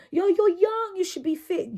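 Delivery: high, loud mock reproach; scolding onlooker. Someone telling her off for struggling on stairs, mock scolding. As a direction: imitate someone chiding you, starting high and loud. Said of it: "I had somebody tell me".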